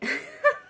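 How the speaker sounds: sudden high squeal; high squeal of laughter. Real, not acted. Reader squealing at the rehab image. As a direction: shriek with laughter.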